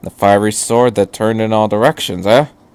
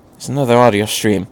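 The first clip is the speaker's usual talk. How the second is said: plain reading voice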